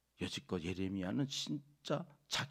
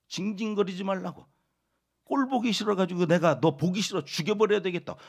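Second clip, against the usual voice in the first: loud, high hostile voice; mock furious threat; 5 semitones higher, 9 dB louder than usual. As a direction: spit out the threat. Voicing listeners threatening Jeremiah. (예레미야를 죽이겠다는 청중 흉내, 분노하며)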